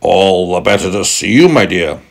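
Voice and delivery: lightly voiced wolf, swooping up on YOU; a hint of a crafty wolf; wolf as Granny. Wolf posing as Granny to the girl, lightly acted voice.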